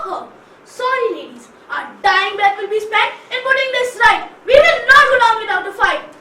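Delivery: high loud rallying call; battle cry. Acted. Writer rousing the town to fight, loud rally. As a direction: rouse them to fight back.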